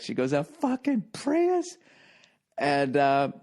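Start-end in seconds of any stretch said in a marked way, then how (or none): 0.3-1.8 much higher, slow, stretched yell of A fucking Prius; hammed-up disgusted shriek; mock outraged yell. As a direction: throw your head back and wail it at him. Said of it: "she threw her head back".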